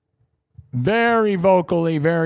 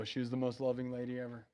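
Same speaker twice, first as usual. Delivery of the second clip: hushed grief; 4 semitones lower, 10 dB quieter than usual. Real, not acted. Streamer telling chat about his late mom, quietly grieving. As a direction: voice drops to almost nothing, much softer.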